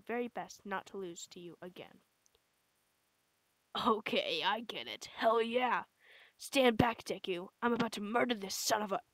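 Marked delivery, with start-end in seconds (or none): none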